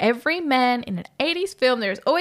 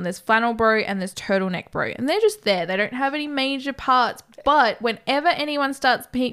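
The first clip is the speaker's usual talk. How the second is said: ordinary talk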